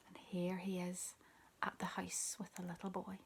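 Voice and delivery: ordinary delivery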